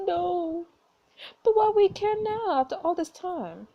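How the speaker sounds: high, loud pleading protest; distressed protest. Acted. Daughter protests to dying mother, distressed.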